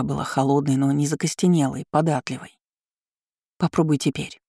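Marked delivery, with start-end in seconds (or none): none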